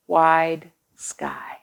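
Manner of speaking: hushed whisper to close the book. Acted. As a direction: breathe the last words like a secret. Whispered last line of book.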